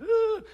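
high, loud imitated bawl; hammed-up high-pitched boo-hoo; mock crying wail. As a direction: briefly imitate a child wailing "ooh". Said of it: "She's not crying, crying, like".

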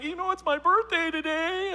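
high, tearful teenage girl imitation; mock bawling. Bawling girl telling the youth leader, mock tearful whine. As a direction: wail it like an upset schoolgirl. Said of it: "she's just bawling".

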